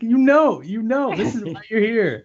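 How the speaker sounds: high-pitched incredulous reminder; mock exasperation. Co-host answers the confused movie workers, mock exasperation. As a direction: insist as if it's glaringly obvious.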